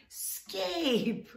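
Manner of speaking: Scape sung out very high; exaggerated explaining. Shown as a demonstration. Teaching a Turkish guest the word scape. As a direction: stretch Scape up high for a foreigner. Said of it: "I'm trying my best over here".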